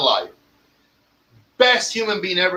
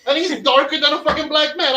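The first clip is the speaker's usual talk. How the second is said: much higher, astonished outburst; amazed exclaim; 9 semitones higher than usual. Retold or imitated. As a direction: play your own wide-eyed disbelief. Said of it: "I was like".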